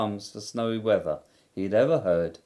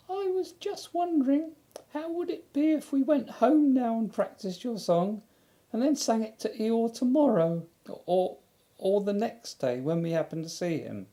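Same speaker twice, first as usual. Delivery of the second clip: very high, small hesitant squeak; a shy piglet nudging his friend; timid Piglet; 14 semitones higher than usual. Acted. Piglet timidly suggesting to Pooh they go home. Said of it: "a little timidly".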